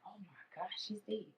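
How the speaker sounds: stunned whisper; a whispered gasp of grief; whispered shock. Retold or imitated. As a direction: breathe it out in disbelief. Retold sudden grief realization.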